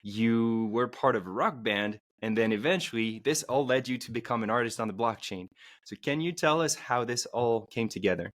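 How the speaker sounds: ordinary talk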